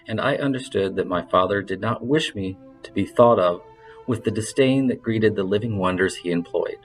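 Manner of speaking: usual speaking voice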